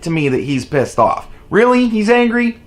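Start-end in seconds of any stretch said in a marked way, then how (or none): 1.5-2.6 sarcastic, higher disbelief; mock surprise. Real, not acted. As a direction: fake shock at the obvious.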